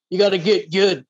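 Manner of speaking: lightly voiced smug tough-guy taunt; Dark Souls boss taunt. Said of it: "channel my inner, uh, Dark Souls, uh, character and say".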